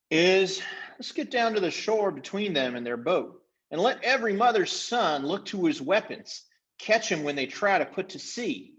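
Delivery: high, bossy chief's voice; scheming chief. Acted. Chief ordering his band to the shore, high and bossy. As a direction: lay out the ambush plan.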